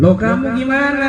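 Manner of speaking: high, sharp rhetorical retort. Retold or imitated. Kiai turning the question back on a complaining parent, high and sharp.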